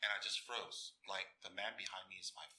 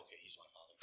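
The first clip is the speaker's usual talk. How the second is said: hushed inner acceptance; 4 semitones lower, 14 dB quieter, faster than usual. Retold or imitated.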